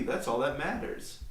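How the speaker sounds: ordinary talk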